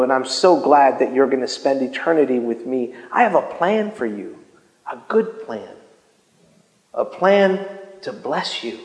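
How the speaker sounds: low, soft and tender; God's loving voice. Retold or imitated. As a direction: speak it gently, like a loving father. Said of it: "and I hear".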